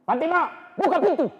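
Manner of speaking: high, loud demand at the door; calling out. Acted. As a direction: bellow her name to open up. Umar pounds on door.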